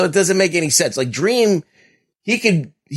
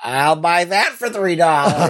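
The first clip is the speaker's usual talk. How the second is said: RoboCop catchphrase; 5 semitones higher than usual. Acted.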